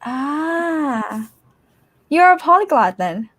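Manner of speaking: normal talking voice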